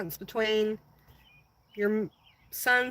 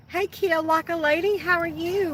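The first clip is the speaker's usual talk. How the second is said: excited greeting; 7 semitones higher, 4 dB louder than usual. Real, not acted. Greeting a new viewer.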